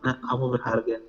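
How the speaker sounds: low, hushed reassurance; soft gentle voice. Acted. God touching a heart, speaking softly. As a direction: drop low and soft, as if close by. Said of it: "terus cuma bilang".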